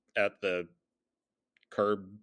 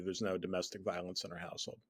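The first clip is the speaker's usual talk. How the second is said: usual speaking voice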